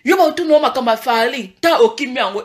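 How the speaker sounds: normal talking voice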